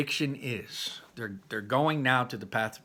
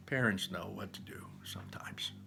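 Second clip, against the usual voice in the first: soft whisper to the listener; whispered aside; 3 semitones lower, 8 dB quieter than usual. Real, not acted. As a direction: let them in on a secret. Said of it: "aside whisper".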